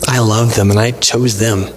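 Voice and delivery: low-pitched, sincere declaration of love; tender, voiced. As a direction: say it low, as God's steady answer. Same answer repeated in low voice.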